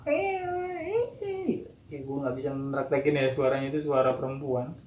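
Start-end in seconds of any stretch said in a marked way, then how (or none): 0.0-1.6 imitated high, eerie ghost laugh; sustained shrill cackle in a put-on high voice; tawa melengking tiruan. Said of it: "dengan ketawa". (tiruan tawa hantu melengking)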